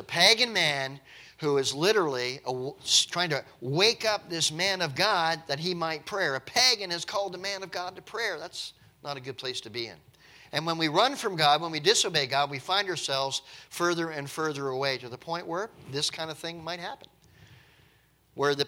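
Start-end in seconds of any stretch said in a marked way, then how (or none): none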